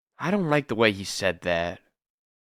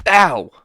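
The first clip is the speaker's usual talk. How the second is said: pained yelp; 6 semitones higher, 6 dB louder than usual. Acted. Hit during the fight.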